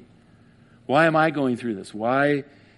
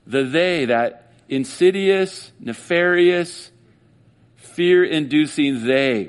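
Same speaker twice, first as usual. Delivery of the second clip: ominous slow mockery; 4 semitones higher, 4 dB louder, much slower than usual. Real, not acted. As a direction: drag out each word with dark relish. Mocking the ominous "they.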